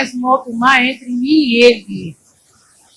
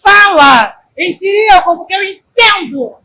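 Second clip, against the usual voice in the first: mock shout; 7 semitones higher, 5 dB louder, slower than usual. Imita alguém pedindo em voz alta.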